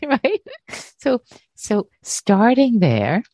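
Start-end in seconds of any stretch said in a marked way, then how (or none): none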